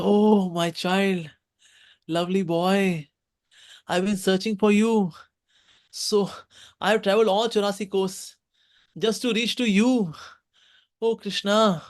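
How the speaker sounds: put-on embrace the child with the voice; tender greeting. Fruit seller tenderly greets child.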